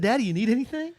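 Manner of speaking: put-on high child voice; little son. Father quoting his young son at the game, high child voice. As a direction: play the eager little boy offering help. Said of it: "He was like".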